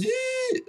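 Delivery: very high, squealed "yee"; high-pitched fake instrument noise; bad instrument impression. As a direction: badly imitate an instrument with one high squeal. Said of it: "worst impression of an instrument".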